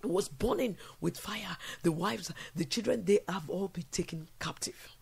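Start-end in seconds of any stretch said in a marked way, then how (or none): 3.0-5.0 whisper. Acted. Sad repeated lament, whispered take.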